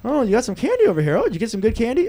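high, sing-song mock-casual prying; nosy feigned innocence. Papa Beal scooting over to the kids' candy, mock-innocent nosiness. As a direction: play the snoop acting casually curious.

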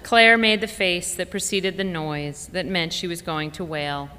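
usual speaking voice

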